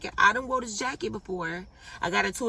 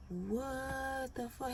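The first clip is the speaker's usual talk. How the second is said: stunned, muttered; 3 semitones higher, 11 dB quieter, much slower than usual. Retold or imitated. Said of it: "he was looking over at me like".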